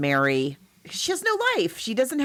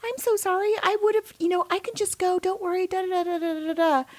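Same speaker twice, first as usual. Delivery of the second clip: high, mock fluttery apology; high apologetic mock; 11 semitones higher than usual. Host imitates Rosemary apologizing to Guy in a high voice. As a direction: squeak out apologies like a meek wife. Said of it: "she's like".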